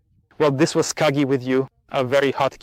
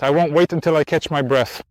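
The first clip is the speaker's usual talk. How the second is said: ordinary talk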